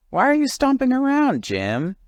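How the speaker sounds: high-pitched bird questioning the grump; curious stork. Acted. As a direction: ask it like a puzzled tall bird. Marabou voiced in story.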